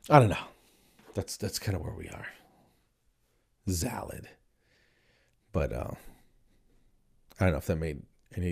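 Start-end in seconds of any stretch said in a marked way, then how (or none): 3.7-4.3 quiet "salad" said as "zalad". Acted. Muttering over the silly menu name, "salad" with Z. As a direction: softly start "salad" with a Z. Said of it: "they put a Z in front of everything".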